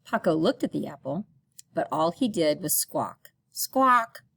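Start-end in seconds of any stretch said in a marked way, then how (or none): 3.5-4.2 high, loud squawking parrot; shrill bird call. Acted. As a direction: screech it like a parrot.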